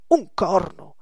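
loud, abrupt refusal; emphatic refusal. Acted. Gillette refuses to pose for another, emphatic. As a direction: refuse it outright, loudly.

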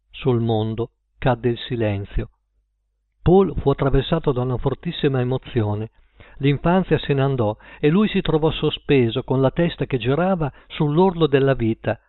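plain reading voice

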